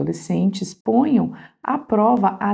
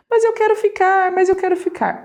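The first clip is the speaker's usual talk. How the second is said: put-on crying child; 12 semitones higher, faster than usual. Son still crying, insisting he wants to stay, high whine. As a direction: whine it like a tearful little boy. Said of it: "O filho ainda chorando".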